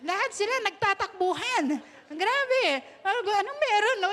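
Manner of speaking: amused, high-pitched laughing talk. Real, not acted. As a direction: giggle through the story of the rushing crowd. Laughing about rushing crowds.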